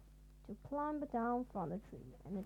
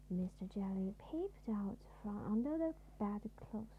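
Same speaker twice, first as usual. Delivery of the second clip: plain reading voice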